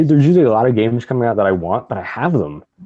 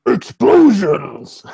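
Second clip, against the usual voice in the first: high, strained, long drawn-out roar; over-the-top wrestling promo shout, every syllable stretched; Macho Man; 13 semitones higher, 4 dB louder, much slower than usual. Acted. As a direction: bellow it as a hammed-up wrestler. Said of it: "read this in my Macho Man voice".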